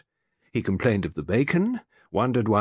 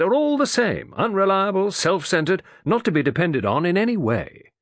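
fussy irritable man; 9 semitones higher, 4 dB louder than usual. A narrator's reading. Mr Morley complaining at breakfast.

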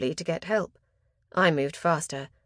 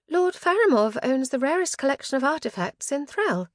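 higher, lilting charm to flatter the host; 7 semitones higher than usual. A narrator's reading. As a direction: put on your most charming voice. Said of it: "donned my most charming voice".

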